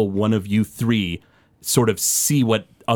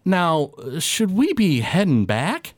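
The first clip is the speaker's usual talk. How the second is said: higher, slow questioning voice; Odaskel; 7 semitones higher, slower than usual. Acted. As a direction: ask it slowly in a raised pitch. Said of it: "Odaskel goes".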